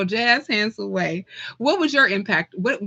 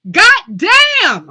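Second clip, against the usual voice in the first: loud, high, full-throated curse; belting the last word of the quote; with emphasis; 11 semitones higher, 9 dB louder than usual. Retold or imitated. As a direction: throw the curse out with force. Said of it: "I had to say that with some emphasis".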